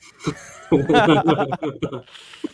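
awkward uneasy chuckle; nervous laugh. Real, not acted. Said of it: "that's a nervous laugh".

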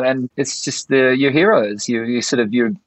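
ordinary talk, nothing marked

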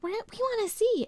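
mock eager guest begging to watch; nosy onlookers. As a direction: plead like guests crowding in. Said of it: "people are like".

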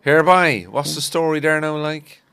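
Cork accent. Shown as a demonstration. Repeating it for a friend to copy, Cork accent. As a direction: demonstrate the Cork greeting clearly. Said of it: "Give us once more".

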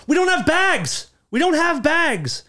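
high, drawn-out mock shout; exaggerated high, slow protesting yell; flustered yelling back. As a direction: holler it back defensively, imitating flustered strangers. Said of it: "they were so flustered".

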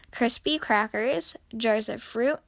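plain reading voice

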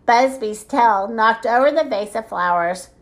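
plain reading voice

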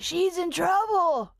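put-on high, slow old man voice; a valiant old man impression; rag wizard voice. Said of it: "impression? ... the rag wizard".

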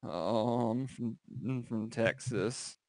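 quiet, hesitant recruit; timid mumble. Retold or imitated. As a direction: answer meekly, barely audible. Retold timid recruit reply.

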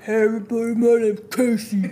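lightly mocking serious host voice. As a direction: play a stiff intro host, lightly. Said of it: "Serious voice".

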